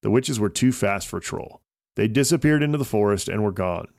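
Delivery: usual speaking voice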